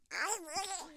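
very high, squawky duck voice; little cartoon duck squawk; Yankee Doodle. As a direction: answer as a frightened duckling. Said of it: "you'll remember it this way".

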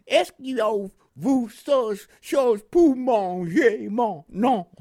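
slow, loud, high Southern drawl in French; French with Southern accent. Retold or imitated. Veteran imitating the Mississippi lieutenant's French, heavy Southern accent. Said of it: "in his French Southern accent".

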